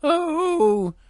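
very high, squeaky wailing bear; high-pitched mortified bear; embarrassed Little Bear. Acted. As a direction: squeak out your embarrassment, drawing out the groans. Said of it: "he was so embarrassed".

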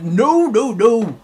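lightly acted no-nonsense bear; firm refusal. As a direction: put a storybook parent's foot down. Big bear refuses firmly.

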